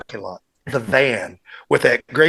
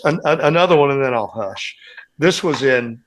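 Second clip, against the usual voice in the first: usual speaking voice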